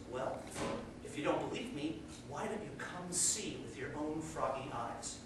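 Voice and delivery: very soft, low-pitched coaxing; gentle invitation. Acted. Sea frog invites doubting well frog out, gentle and hushed. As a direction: drop right down and coax quietly.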